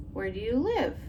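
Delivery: ordinary talk, nothing marked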